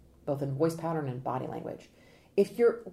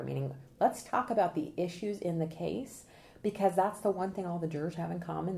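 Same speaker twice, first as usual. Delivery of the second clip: usual speaking voice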